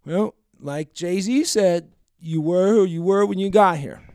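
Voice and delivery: lightly mock a tipsy woman quoting a lyric; mild drunk woman impression. Retelling the drunk woman's line at the bar. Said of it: "she says something in response to me".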